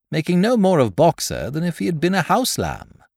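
ordinary delivery

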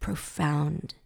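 slow, hushed gravity with pauses; low and weighty, word by word; mock solemn. Describing a very serious rabbi's stories, mock solemn.

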